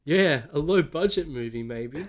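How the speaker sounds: English accent attempt. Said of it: "It was, it was even less like".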